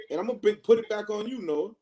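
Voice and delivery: normal talking voice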